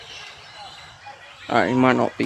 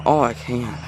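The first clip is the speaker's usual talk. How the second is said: ordinary delivery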